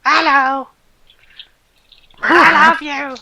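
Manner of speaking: Chloe's voice. Acted.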